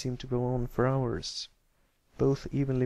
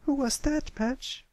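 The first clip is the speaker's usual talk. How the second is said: higher, leaning-in curious colt; eager question; 14 semitones higher than usual. A narrator's reading. Pipsqueak leaning in to ask Patch, eager. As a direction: hang on every word. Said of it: "leaned in, getting engrossed".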